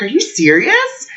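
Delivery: high-pitched incredulous question; retold astonished reaction; acted-out disbelief. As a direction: re-enact being floored, voice jumping up. Retells being floored by the news.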